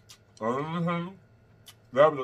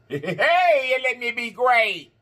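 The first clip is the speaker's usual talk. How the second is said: high, slow victorious sing-song; triumphant cheer; 7 semitones higher, much slower than usual. Real, not acted. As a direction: celebrate getting the meat out. Triumph over the meat.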